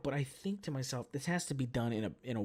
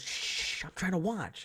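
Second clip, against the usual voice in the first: mock irritated mom shush; 8 semitones higher, 5 dB louder than usual. Mom to talker during the movie, shushing. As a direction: hush them sharply, imitating an annoyed mom. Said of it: "my mom just goes".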